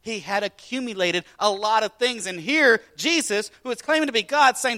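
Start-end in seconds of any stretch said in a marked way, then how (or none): none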